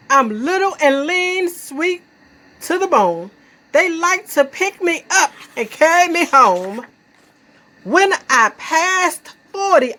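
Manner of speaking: high, swinging poetry performance; proud, high-pitched verse with punched rhymes; sassy poem recital. Acted. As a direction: recite it boastfully with playful lilt.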